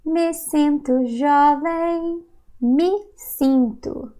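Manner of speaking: Me sinto jovem said slowly and clearly; Me sinto stretched out; careful Portuguese demo; slow model phrase. Shown as a demonstration. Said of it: "This is how we say".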